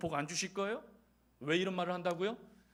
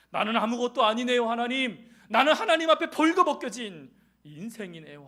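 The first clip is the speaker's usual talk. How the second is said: high, loud cry to God; crying-out confession; 4 semitones higher, 10 dB louder than usual. Acted. Voicing Paul confessing to God, loud and high. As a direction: cry out your nothingness to God. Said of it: "사도 바울처럼 깨닫고 나니".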